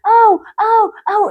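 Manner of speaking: high "au" yelps; re-enacted cries of pain; imitated pain yelps. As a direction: act out being hurt, ow by ow. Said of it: "a mí me dolía. Yo así".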